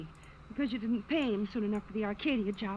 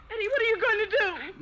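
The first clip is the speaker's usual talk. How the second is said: frightened; 9 semitones higher, 5 dB louder, faster than usual. Acted. Pleading with a gunman.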